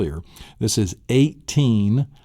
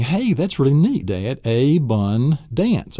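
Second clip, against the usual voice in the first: put-on higher-pitched child, pleased with the discovery; son's delight; 6 semitones higher, slower than usual. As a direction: play the son marvelling to Dad. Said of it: "And so he's saying".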